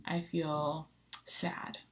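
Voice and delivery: put-on slow breathy whisper of a sad child. Pastor quoting a fourth grader's sadness, slow whisper. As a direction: let it fall to a slow whisper. Child retelling feelings, last one.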